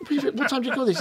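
hammed-up nagging partner, pitched high; nagging spouse. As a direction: scold him as if he came home late for dinner. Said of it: "tells him off as though somehow it's his other half's come home".

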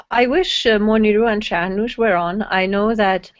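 ordinary talk

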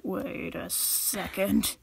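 very slow, dawning self-check; catching oneself. Retold or imitated. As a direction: draw it out, stopping yourself short. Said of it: "then sort of been like".

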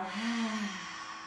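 soft open-mouth breath out; audible exhale sigh. Shown as a demonstration.

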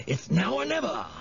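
high, insistent creature voice; urgent goading. A narrator's reading. Jive pushes Harvey to pounce at once, goading urgently. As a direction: press hard for action.

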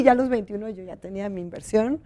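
ordinary talk, nothing marked

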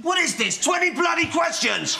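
high-pitched exasperated yell; sudden irritated outburst, pitched high; angry shout. Acted. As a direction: explode at being questioned. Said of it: "I didn't mean to shout at ya".

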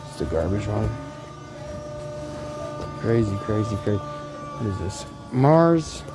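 normal talking voice

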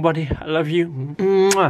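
ordinary talk

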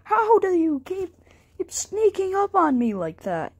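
high, loud startled complaint. Pretend. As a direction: jump at the surprise and protest. Startled by sudden fanfare.

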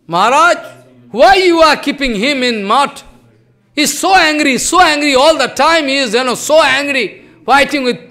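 complaining loudly. Retold or imitated. Devotees loudly complaining to Maharaj about the angry man. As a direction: press the complaint hard, voice up.

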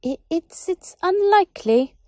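high, stammering and scared; frightened small wizard; nervous stammer. Acted. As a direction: stumble over the words in fear of the master. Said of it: "The small wizard looked more nervous".

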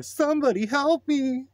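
very high, loud shriek for help; panicked trucker's high wail; terrified scream. A narrator's reading. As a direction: scream it as if about to be killed. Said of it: "screamed the trucker".

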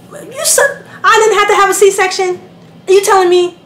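high, loud disbelief; incredulous outrage. Retold or imitated. Mother confronting the doctor, high incredulous outrage.

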